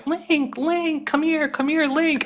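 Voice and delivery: high calling voice, mock fans; calling out ladies. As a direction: call across the stands like eager middle-aged fans. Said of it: "they're going".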